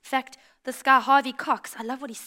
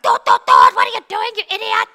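high, loud angry driver outburst; road-rage yelling; 9 semitones higher, 13 dB louder than usual. Acted. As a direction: yell at the idiot in the other car. Acting out parking lot road rage.

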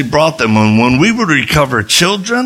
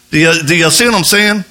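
ordinary delivery

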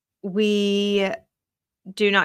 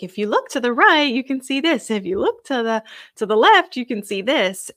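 lightly voiced tour guide, higher and lilting; a hint of a tour guide pointing out sights; 4 semitones higher, 5 dB louder than usual. Tour guide showing passengers the sights, lightly imitated. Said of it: "and he's like".

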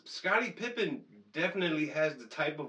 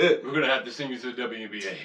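fast, lower mock announcement; deadpan official; 4 semitones lower, faster than usual. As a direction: deliver bad news like an official. Acts official sending him off.

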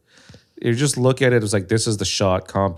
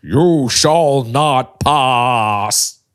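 slow, loud, booming command; hammed-up epic movie wizard; wizard impression; 2 semitones higher, 5 dB louder, much slower than usual. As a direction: draw out each word forcefully. Movie impression after weird voices.

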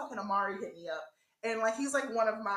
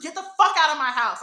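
louder, furious command; angry order; 4 semitones higher, 11 dB louder than usual. Retold or imitated. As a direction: kick him out of the house. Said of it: "I was like".

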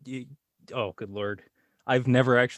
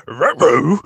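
higher, loud dog voice saying Ruh-roh; Scooby-Doo; 6 semitones higher, 13 dB louder than usual. Pretend. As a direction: bark it out like a cartoon dog. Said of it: "can you do a Shaggy or a Scooby?".

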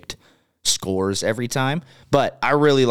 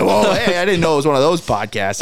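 higher mock-startled listener voice; put-off listener; 6 semitones higher, 4 dB louder than usual. Host imitating a listener quitting the podcast, mock alarm. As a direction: act out a listener bailing, laugh behind it. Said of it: "They're like".

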